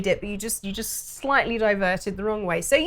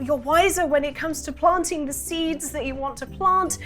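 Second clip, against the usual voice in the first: flustered baker; 2 semitones higher than usual. Acted. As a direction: pour out the problem in a rush. Distressed baker explains problem.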